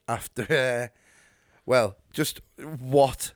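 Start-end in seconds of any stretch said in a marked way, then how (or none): none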